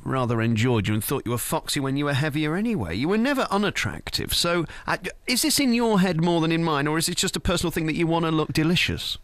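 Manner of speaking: usual speaking voice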